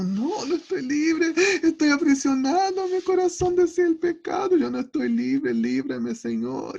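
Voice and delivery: a hammed-up protest that I'm still imprisoned; desperate protest. Preacher acting out his own protest to the Lord, a mock-desperate high wail. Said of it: "Y yo como".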